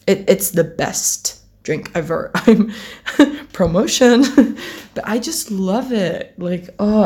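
ordinary talk

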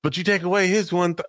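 high, fast mock wrestler promo; imitating a wrestler. Friend quoting the wrestler's odd math. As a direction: rattle off the math like him. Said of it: "like, going".

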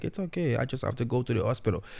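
hushed, no-fuss acted calm; calm and collected. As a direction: imitate an unfazed man brushing off worry. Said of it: "calm and collected and said".

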